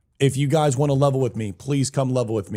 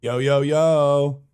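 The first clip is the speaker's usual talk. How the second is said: drawn-out greeting; 4 dB louder, much slower than usual. Real, not acted. Greeting in chat.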